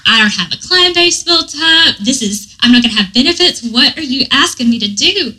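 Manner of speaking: mock-panicked objection, half laughing; panicked protest. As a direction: pile up the worries, rushing and alarmed. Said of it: "And I was like".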